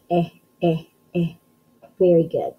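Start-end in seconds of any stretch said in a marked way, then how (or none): 0.1-1.3 short eh sound said three times; isolated letter sound. Shown as a demonstration. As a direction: clip the vowel: eh, eh, eh. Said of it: "What about the word elephant?".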